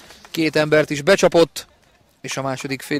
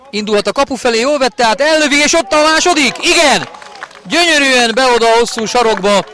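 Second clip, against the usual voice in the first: higher, louder rising goal call; excited hungarian sports commentary; 9 semitones higher, 7 dB louder than usual. Real, not acted. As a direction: build up and explode on the goal.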